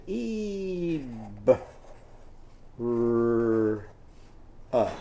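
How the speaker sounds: ibra sounded out piece by piece; a teacher sounding out pig latin; segmenting sounds. Shown as a demonstration. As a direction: pull each sound apart. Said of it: "once again".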